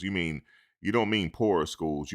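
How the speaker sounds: ordinary delivery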